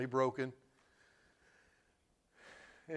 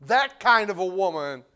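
a hint of a scornful critic, leaning on THAT; quoted religious disgust; 3 semitones higher, 6 dB louder than usual. Preacher echoing what the woman got called, lightly voiced disgust. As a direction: stress THAT with imitated disapproval. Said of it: "by the religious with disgust".

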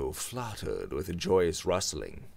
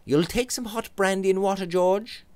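higher coaxing friend; Robert's voice; 12 semitones higher, 4 dB louder than usual. Acted. Robert offers George brandy, higher and coaxing. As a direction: offer it gently.

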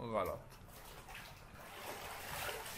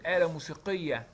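usual speaking voice

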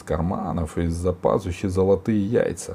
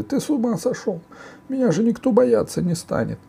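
high-pitched whining ogre voice; indignant ogre; 4 dB louder than usual. A narrator's reading. Ogre scolding the warrior over his beard, high protest.

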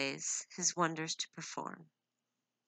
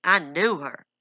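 a louder, harsh snap; 11 dB louder than usual. A narrator's reading. Husband cutting off his wife's defense, harsh. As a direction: bite the words off hard. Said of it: "he said harshly".

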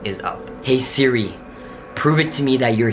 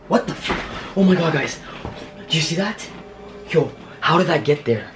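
scared; 7 semitones higher than usual. Real, not acted. Scared by something appearing.

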